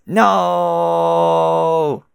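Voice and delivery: high, loud, sustained wail; big breath, then hold the cry; full-out drawn-out scream of denial; long dramatic no. Acted. Second long cry after deep breath.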